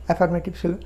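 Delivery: ordinary talk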